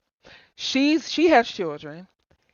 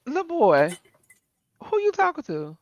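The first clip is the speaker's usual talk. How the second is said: mock-stern challenge; 6 semitones higher, 4 dB quieter than usual. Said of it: "I'd be like".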